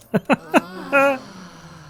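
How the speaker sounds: high, delighted giggle; delighted laugh. Real, not acted. Delighted at the armour's look.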